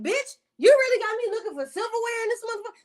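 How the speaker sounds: high, fast, incredulous outburst; mad. Retold or imitated. Storyteller confronting friend over the silverware search, mad and incredulous. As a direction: retell it as a rapid high-pitched protest. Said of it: "I'm mad".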